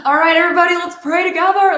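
high, loud, hammed-up group leader; rallying prayer leader. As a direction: call the whole crowd to prayer, mock-eager. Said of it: "I'm not like".

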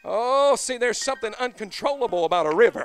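loud, high, laughing preacher; carried away by the spirit; shouting preacher full of joy; ecstatic preaching. Real, not acted.

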